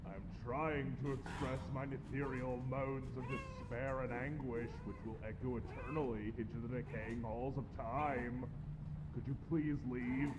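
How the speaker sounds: quiet moaning. Acted. Ghost moaning from machine.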